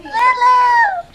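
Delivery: high bright call to the dog; excited call. Real, not acted. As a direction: call out the name happily.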